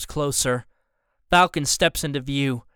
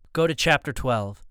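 ordinary delivery

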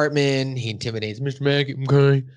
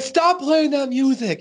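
mock yell; 14 semitones higher, 4 dB louder, slower than usual. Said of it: "then he yelled at his friend".

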